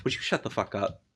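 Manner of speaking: deadpan it; dry, sardonic. Acted. Elle turning on an overly serious speaker, dryly sardonic. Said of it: "somebody dryly and sardonically".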